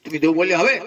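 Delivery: usual speaking voice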